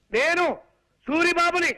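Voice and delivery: forceful, emphatic self-introduction; loud shouting. Acted. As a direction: call it out for someone hard of hearing. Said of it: "అంత గట్టిగా అరవకు నాయనా".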